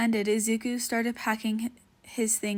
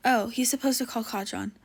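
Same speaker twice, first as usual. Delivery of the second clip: ordinary delivery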